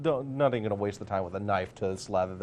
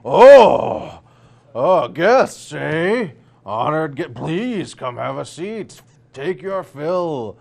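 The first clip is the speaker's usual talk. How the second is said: loud, high, slow jolly glutton; booming mayor voice; 5 semitones higher, 15 dB louder, much slower than usual. Acted. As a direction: bellow it out like a huge jovial host. Mayor greets guests at feast.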